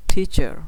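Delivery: Teacher loud and high, punching টি; stress on first syllable. Shown as a demonstration. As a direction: hit the টি of teacher hard. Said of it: "এটা একটু জোরে বলতে হবে".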